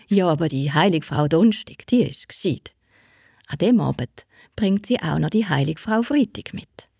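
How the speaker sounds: ordinary delivery, nothing marked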